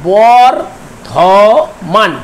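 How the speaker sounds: very slow Bardhaman, broken as বর-ধ-মান with gaps; syllable by syllable. Shown as a demonstration. Teacher dividing the word for students, syllable by syllable. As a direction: draw out Bardhaman in three separate beats. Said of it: "এখানে তিনটি দল আছে".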